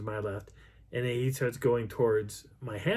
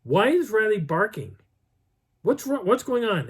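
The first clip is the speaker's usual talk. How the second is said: high, loud questioning; mock a woman woken by barking; high-pitched exasperated questions; alarmed woman demanding answers; 9 semitones higher, 5 dB louder than usual. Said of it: "Darla turns around and goes".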